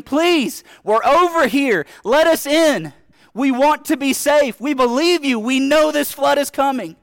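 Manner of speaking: high, loud desperate calling across the water; yelling and pleading. Pretend. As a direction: scream to the boat to be let in. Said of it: "you're yelling and you're screaming".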